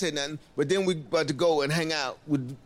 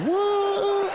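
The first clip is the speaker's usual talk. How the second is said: very high, loud surprised yelp; shocked what; 4 dB louder than usual. Retold or imitated. Comic hearing the tour guide, loud shocked yelp. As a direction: squeak it in disbelief. Told the men are Indians.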